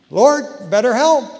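a half-hammed plea from someone slipping; mock panicked prayer. Man hanging on by a pinky calling to the Lord, loud urgent plea.

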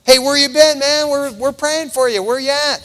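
eager church brother checking in. Acted. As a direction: call out to someone who's been missing. Acts out concerned church brothers calling.

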